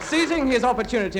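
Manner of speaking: ordinary delivery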